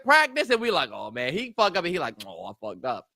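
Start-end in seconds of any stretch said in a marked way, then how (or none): 0.9-1.3 low, soft mock dismay; mock dejection. Player after messing up in practice, mock dejection. 2.3-3.1 low, soft mumbled admission; offhand mock admission. Coach's son messing up in practice, offhand mock admission.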